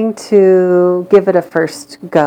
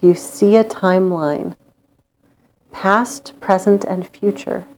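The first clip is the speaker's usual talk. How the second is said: guided meditation. Acted. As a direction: lull them into calm with long pauses.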